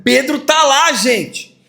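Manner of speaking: put-on girl insisting to the doubters; insistent girl. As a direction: plead that it's true. Girl insists Peter is there.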